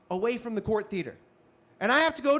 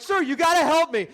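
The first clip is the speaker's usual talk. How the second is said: high, loud urgent plea; acted panicky appeal; frantic plea; 7 semitones higher, 5 dB louder than usual. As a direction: beg an officer for help. Begging a police officer while fleeing.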